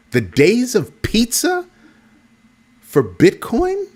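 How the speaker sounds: high, slow incredulous question; mock shock at pizza for Bitcoin; mock disbelief. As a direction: stretch it out like it's absurd.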